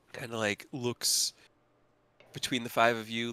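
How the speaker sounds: normal talking voice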